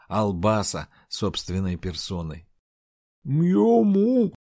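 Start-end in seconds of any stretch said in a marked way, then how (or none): 3.2-4.3 muffled hum through shut lips; talking with mouth closed. Acted. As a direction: moan it with jaw locked. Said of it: "промычала Айжар, едва приоткрыв непослушный рот".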